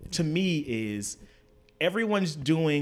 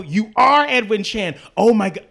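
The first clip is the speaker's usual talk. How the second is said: high and much louder, thrilled gush; gushing fan; 8 semitones higher, 12 dB louder than usual. Retold or imitated. Telling Edwin Chan he is a huge fan, excited. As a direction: gush at him like a giddy fan.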